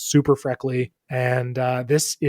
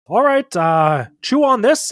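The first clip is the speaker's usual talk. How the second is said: loud doctor voice, rising high; doctor character; 8 semitones higher, 7 dB louder than usual. Acted. Doctor handing his patient a leaf, loud and lifted. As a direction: pitch it up and project.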